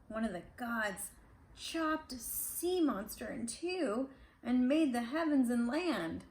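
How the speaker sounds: plain reading voice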